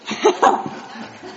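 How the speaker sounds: short breathy burst of laughter. Real, not acted. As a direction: laugh at your own props. Laughs while showing props.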